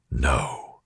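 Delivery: a soft, low "No"; said softly. A narrator's reading. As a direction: let the "No" drop low and soft. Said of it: "he said softly".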